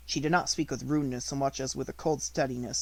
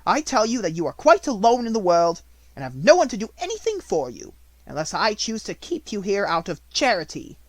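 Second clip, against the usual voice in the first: much louder, higher berating; harsh scolding; 8 semitones higher, 9 dB louder than usual. A narrator's reading. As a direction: tear into her coldly. Minchin berates Sarah.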